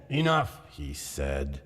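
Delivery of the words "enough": higher, curt dismissal; a touch of a drunk friend's bravado; Fortunato, brushing it off. A narrator's reading. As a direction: wave the worry away.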